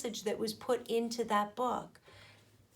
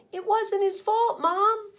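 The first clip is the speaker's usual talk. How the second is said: pitched up high and a bit loud; high pleading voice; 11 semitones higher, 5 dB louder than usual. A narrator's reading. Spider pleads with Fly's mom in a high voice. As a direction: plead the innocent child's case.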